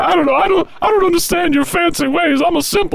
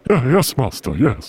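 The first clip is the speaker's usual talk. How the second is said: low, croaky servant voice; hunchback assistant; 3 dB quieter than usual. Acted. Igor answers his master.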